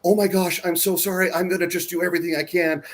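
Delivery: high, overdone fussing, quickening at the end; mock gushing apology. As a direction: ham up an overly sorry helper. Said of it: "they make a big deal out of it".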